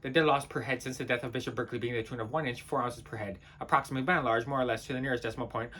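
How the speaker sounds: fast, low, quiet gabble; rattled-off statistics. Acted. Reciting loss per head figures, gabbled low. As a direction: race through it under your breath.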